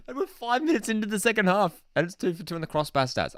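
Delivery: high-pitched, incredulous recap; amazed disbelief. Real, not acted. Host recapping crossbar hits five minutes in, amazed disbelief. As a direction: insist on the absurd stat in disbelief.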